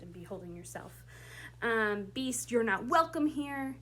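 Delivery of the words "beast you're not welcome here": higher, louder, leaning on "welcome"; forceful line reading. Shown as a demonstration. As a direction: push "welcome" out firmly. Director performs Beast's line.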